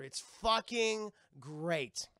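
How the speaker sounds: ordinary talk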